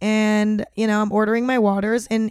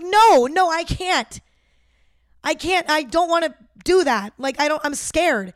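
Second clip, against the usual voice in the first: high, frightened pleading, retold; reliving the panic, begging not to hand over the card; panicked refusal; 5 semitones higher than usual. To the Wendy's worker, a retold frightened high plea.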